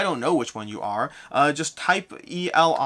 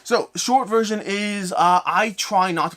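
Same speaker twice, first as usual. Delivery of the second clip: slow, deliberate restart; 6 semitones higher, 4 dB louder, slower than usual. Real, not acted. Streamer catches himself talking too fast, slowing down. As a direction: stretch the words out after rushing. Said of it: "let me slow down".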